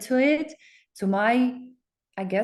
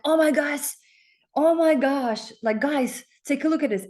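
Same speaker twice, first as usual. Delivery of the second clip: higher, excitedly calling family over; pitched up, thrilled oh my gosh; excited gushing; 6 semitones higher, 3 dB louder than usual. Retold or imitated. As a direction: gush with retold amazement. Excited discovery, showing family.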